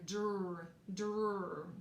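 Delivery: Dr isolated and said crisply; teacher modelling the blend Dr; sounding out the blend. Shown as a demonstration. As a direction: say the blend Dr on its own, twice. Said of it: "I hear another blend".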